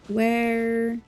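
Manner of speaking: normal talking voice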